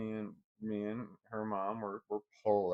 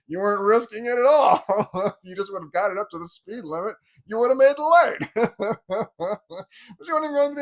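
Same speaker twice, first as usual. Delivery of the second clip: much higher, much louder, exasperated; exasperated shout; 10 semitones higher, 12 dB louder than usual. Retold or imitated. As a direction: shout at her that she'd have made it. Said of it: "I'm like".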